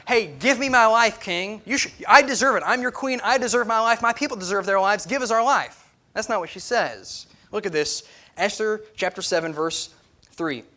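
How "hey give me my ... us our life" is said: a pushy, entitled demand, higher and louder; mock demanding queen. Preacher mocks how a rude queen would demand from the king. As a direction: act out a rude queen who demands her life. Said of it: "She's not".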